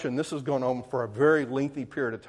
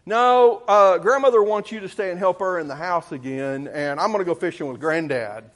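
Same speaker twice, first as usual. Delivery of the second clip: sister's bossy cut-in; 4 semitones higher, 8 dB louder than usual. Retold or imitated. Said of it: "she goes".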